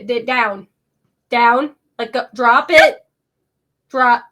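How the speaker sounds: loud, sharp commands: drop it; firm dog command. Acted. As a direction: order the dog to let go.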